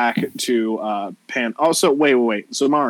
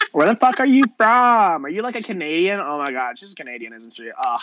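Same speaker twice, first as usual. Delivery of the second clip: put-on appalled Valley girl; 8 semitones higher than usual. Valley girl discovering the newcomer is Canadian, appalled. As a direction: quiz her, then complain under it.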